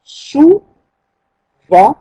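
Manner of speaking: slow, drawn-out Sou-vent; dictating Souvent slowly to the class; split into syllables. Shown as a demonstration. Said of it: "découpons des verbes ensemble".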